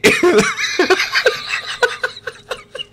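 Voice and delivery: long, high helpless laughing fit; real laughter that keeps going; uncontrollable laughter. Real, not acted. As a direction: crack up completely. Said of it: "I can't stop laughing".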